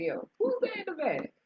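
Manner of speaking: suspicious family member. Retold or imitated. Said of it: "every family member's like".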